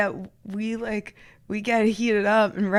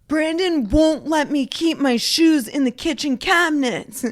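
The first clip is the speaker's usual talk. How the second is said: high, loud, worked-up protest; indignant complaint; 5 semitones higher, 7 dB louder than usual. Retold or imitated. Telling a coworker about the fight, indignant and loud. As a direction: whine it like the wronged party. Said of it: "and I was like".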